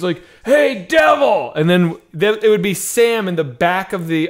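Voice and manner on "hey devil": loud, high, drawn-out shout; mock challenger's yell; shouted heckle. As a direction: holler it to the back of a theater. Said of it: "suddenly you hear this".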